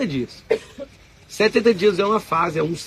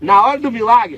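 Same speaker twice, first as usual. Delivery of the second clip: ordinary talk, nothing marked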